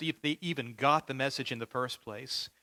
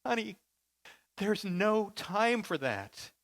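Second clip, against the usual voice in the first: mild laughing-crying father; 7 semitones higher, slower than usual. Retold or imitated. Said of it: "begins to laugh and to cry so hard".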